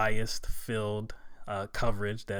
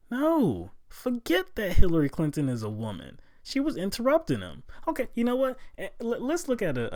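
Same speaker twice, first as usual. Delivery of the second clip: much higher, louder protest; exasperated outburst; 11 semitones higher, 6 dB louder than usual. Real, not acted. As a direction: push back hard, fed up. Exasperated rebuttal.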